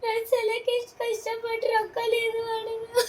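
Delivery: real tearful voice, high and soft; crying. Real, not acted. Daughter moved by her parents' hard work, crying. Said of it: "ಯಾಕೆ ಅಳತಿ?".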